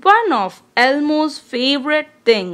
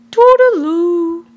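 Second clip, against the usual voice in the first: cheerful goodbye; 5 semitones higher, 4 dB louder than usual. Acted. Calling goodbye again.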